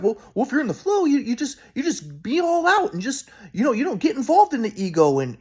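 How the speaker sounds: high, airy guru grandpa. Retold or imitated. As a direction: preach the wise-grandpa line lightly. Grandpa's advice, voiced.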